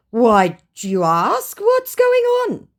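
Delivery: high, loud baffled scammer; slow then squeaky puzzlement; mock confused scammer. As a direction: play him lost and confused. Scammer's confused reply voiced.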